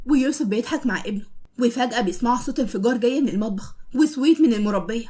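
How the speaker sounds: usual speaking voice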